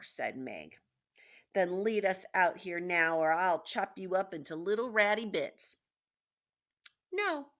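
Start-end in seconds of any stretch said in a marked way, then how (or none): 1.5-5.8 loud, forceful ultimatum; raised-voice servant girl's threat; threatening. A narrator's reading. Meg threatens the rat with knife. 7.1-7.5 high, loud "no"; sharp raised-voice character refusal; defiant refusal. A narrator's reading. Rat refuses loudly.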